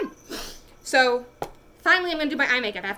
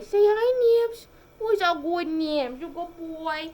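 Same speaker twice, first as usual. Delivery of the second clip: pet talk; 6 semitones higher, slower than usual. Real, not acted. Praising her cat in high baby talk. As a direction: coo at the cat.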